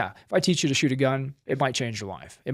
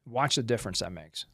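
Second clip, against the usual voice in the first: ordinary talk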